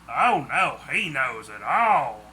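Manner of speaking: irritated complaint that ends low and heavy; angry grumbling. Acted. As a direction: grumble crossly about a friend. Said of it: "Claude said angrily".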